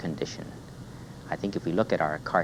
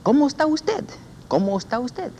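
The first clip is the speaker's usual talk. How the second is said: much higher, lilting Cómo está usted; high sing-song greeting. Shown as a demonstration. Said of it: "repítala, repita".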